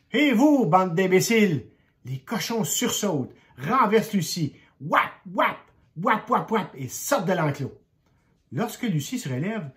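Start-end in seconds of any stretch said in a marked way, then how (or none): 0.1-1.6 high, loud yell at the pigs; shout. A narrator's reading. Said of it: "Lucie leur crie". 4.8-6.7 stamp out the pigs' hooves; galloping sound. A narrator's reading. Cochons qui s'enfuient, bruit.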